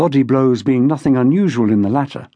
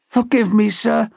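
higher-pitched, mildly croaky plea; a touch of a croak, pitched up; croaked apology; 5 semitones higher than usual. A narrator's reading. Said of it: "Toby croaked".

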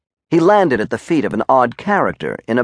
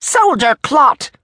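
high, slow outburst at a soldier; exploding Major; 4 dB louder, slower than usual. A narrator's reading. Major exploding at the soldier who turned up, high and drawn out. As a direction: explode on each drawn-out word. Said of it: "he exploded".

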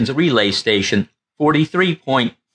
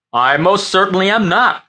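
higher, louder firm denial; emphatic; 7 semitones higher, 3 dB louder than usual. Acted. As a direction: hit every word, offended. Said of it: "Captain Frommer said emphatically".

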